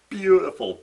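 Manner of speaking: loud, high delight; a burst of fan joy; excited cheer. Real, not acted. Cheering the hammer breaking.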